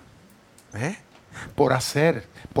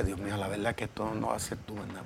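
hushed plea to God; 5 semitones lower, 13 dB quieter than usual. Retold or imitated. Private plea to God, retold.

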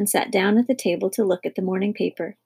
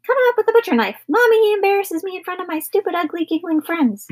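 very high, shrieky whine; hysterical sister; 10 semitones higher than usual. Acted. Sister complaining to Mommy about her brother, high hysterical whine. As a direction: wail it way up high in a tantrum.

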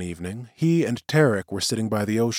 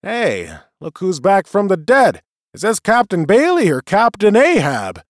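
loud, high bar crony; boisterous greeting; 9 semitones higher, 6 dB louder than usual. A narrator's reading. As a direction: hail him across the bar. Bar crony greeting Bailey loudly.

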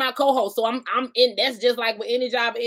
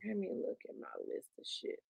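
whispering; 3 semitones lower, 22 dB quieter, slower than usual. Real, not acted. Muttering to herself.

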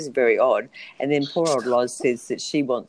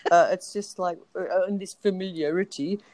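slightly higher, slipping into South African; South African accent; 6 semitones higher than usual. Real, not acted. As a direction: let a hint of South African creep in. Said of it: "I think that was South African".

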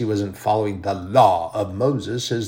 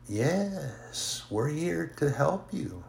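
higher, softer, slowed and cooing false comfort; sing-songy minions; 5 semitones higher, 5 dB quieter, much slower than usual. Retold or imitated. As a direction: purr the offer of help like a mock-sweet tempter. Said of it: "this little sing-songy thing they do".

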